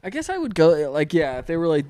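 normal talking voice

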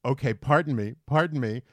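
lightly acted bid to get past; retold polite interruption. Acting out squeezing past the couple.

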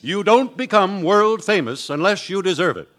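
ordinary talk, nothing marked

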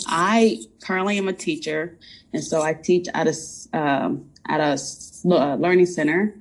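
ordinary delivery

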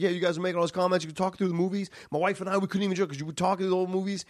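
fast, high-pitched griping; rapid indignant mock rant; retold complaint. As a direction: imitate an aggrieved moviegoer in a rush. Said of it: "he's like".

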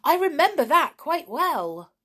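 high, loud and eager; excitedly sure of the memory. A narrator's reading. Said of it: "said Perry excitedly".

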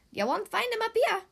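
chirpy bird voice. Acted. Bird tells Big Macs the elephant isn't up there, high bird voice. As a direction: squeak it out like a helpful bird.

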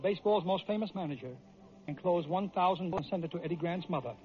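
plain reading voice

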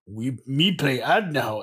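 lightly voiced caveman; hint of a dumb caveman grunt. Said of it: "caveman voice".

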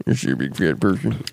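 low, put-on villain voice; mock serial killer questioning, pitched low; movie killer impression. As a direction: drop deep like a creepy film killer. Joins in Buffalo Bill impressions.

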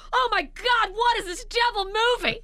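high horrified shriek. Retold or imitated. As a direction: squeal at the scary movie. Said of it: "I was like".

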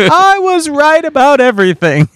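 very high teasing imitation; mock half-octave rise. Teasing co-host over his gloating, mock high voice. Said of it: "half octave your voice goes up".

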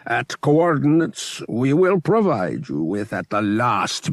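a powerful priest granting a favor, unhurried. Acted. High Priest gives orders.

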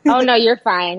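ordinary talk

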